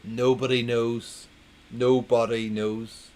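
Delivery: slow, weighty "Nobody knows"; punchline read with heavy emphasis. Shown as a demonstration. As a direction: lean on "Nobody", slowly. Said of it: "put the emphasis on the joke".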